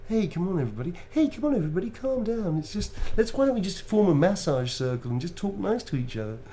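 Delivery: high, dreamy peacemaker voice; spaced-out hippie. Retold or imitated. Player imitates his spaced-out character calming the party, high and dreamy. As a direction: float it out, calming a brawl. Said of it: "she talks like that".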